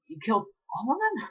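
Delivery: high, horrified question; shocked question. Acted. Filly asks if everyone is dead, high and shocked. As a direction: ask it in horror.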